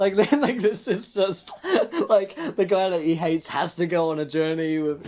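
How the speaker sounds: laughing through the words; giggling while explaining. Real, not acted. Player laughing at rom-com idea.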